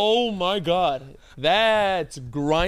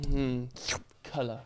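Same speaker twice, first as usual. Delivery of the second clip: soft, low musing to himself; hushed, low pondering of a new idea; Van Gogh; 5 semitones lower, 9 dB quieter than usual. Pretend. Friend mocking the idea that Van Gogh invented color, quiet musing. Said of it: "Van Gogh is just sitting there like".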